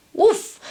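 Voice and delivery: high loud woof; dog bark. Acted. Dog's bark.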